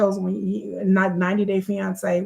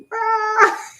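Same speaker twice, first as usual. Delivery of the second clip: shrill burst of laughter; high squealing laugh; 14 semitones higher, 3 dB louder than usual. Real, not acted. Laughs at own joke.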